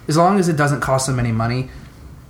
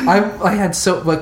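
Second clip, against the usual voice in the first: higher, still laughing; 7 semitones higher, 3 dB louder than usual. Real, not acted. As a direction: talk through the last of the laugh. Still laughing mid-story.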